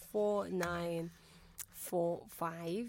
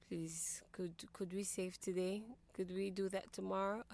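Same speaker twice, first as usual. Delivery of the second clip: tired pleading request; 8 dB quieter than usual. Retold or imitated. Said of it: "I'd be like".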